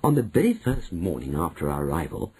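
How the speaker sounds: plain reading voice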